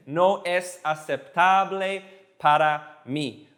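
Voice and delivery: "No es aceptable para mí" very slowly, word by word; slow demonstration. Shown as a demonstration. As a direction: spell out each word of "No es aceptable para mí" with pauses. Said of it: "And if you say it fast".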